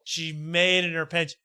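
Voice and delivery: ordinary delivery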